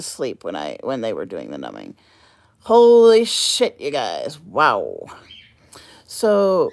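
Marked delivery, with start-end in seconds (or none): 2.6-5.1 stunned exclamation. Real, not acted. Reacting to the anesthesia memory.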